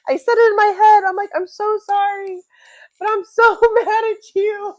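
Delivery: high, loud panicked babble; frantic retold panic. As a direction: voce aguda y fuerte, pánico fingido entre risas. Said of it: "And I'm like".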